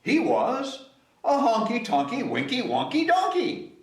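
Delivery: plain reading voice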